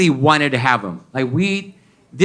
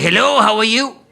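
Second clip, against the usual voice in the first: put-on high, sing-song French-accented greeting; 7 semitones higher, 3 dB louder than usual. French Uber driver greeting his passenger, French accent. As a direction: imitate a Frenchman's chirpy English hello. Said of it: "was a French guy".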